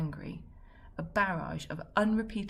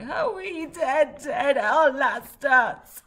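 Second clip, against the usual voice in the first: high, slow, wailing through tears; blubbering the words out, chin wobbling; choked sobbing words; 8 semitones higher, 12 dB louder, much slower than usual. Acted. Fred sobbing out his grief to Dad, wailing and garbled. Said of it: "But I think it sounded like".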